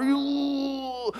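high, drawn-out straining "ooh"; long pent-up whine; stifled-laughter squeal. Retold or imitated. Imitates holding in church laughter.

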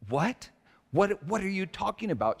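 high, acted bewilderment; startled disbelief. Acted startled reply to panicking wife.

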